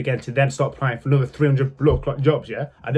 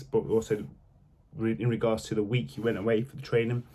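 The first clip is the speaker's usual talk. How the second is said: low, measured official tone, a touch softer; lightly voiced manager; 7 semitones lower, 6 dB quieter than usual. Manager explains the dismissal to a new employee, lightly voiced and low. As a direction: lightly imitate a manager letting someone down.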